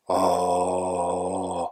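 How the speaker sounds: long open-throat "ah"; a held demo ah. Shown as a demonstration. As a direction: sing out a flat-tongued open ah. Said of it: "is open throat sound".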